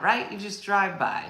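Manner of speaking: ordinary talk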